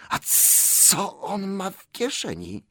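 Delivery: high, slow, hissing creature; sharp hiss. A narrator's reading. Gollum suspects Bilbo's pocket, hissing sharply. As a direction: hiss it suspiciously, stretching the sibilants. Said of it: "odezwał się ostry syk".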